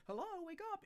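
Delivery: high squeaky wake-up call; mock shrill wake-up.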